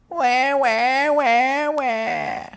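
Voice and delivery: mocking whine. Storyteller mimics a bored child at home, mocking whine. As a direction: imitate a complaining child's whine. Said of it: "you could be a whiner".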